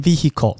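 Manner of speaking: vehicle said wrongly, with a clear h; wrong pronunciation. Shown as a demonstration. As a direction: mispronounce vehicle by sounding the h. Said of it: "不是念vehicle这样子哦". (错误读法vehicle，h发出来)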